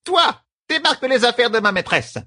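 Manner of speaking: very high, commanding character voice; bossy servant. A narrator's reading. Servant ordering a man to unload, bossy. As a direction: order him about in a high stern voice.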